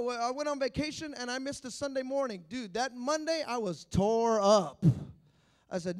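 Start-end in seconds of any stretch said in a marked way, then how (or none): none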